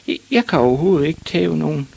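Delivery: put-on high, quick stammering child; high-pitched meek protest; timid small boy. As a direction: stumble over the first word, then hurry on. Ivan stammers to shouting father.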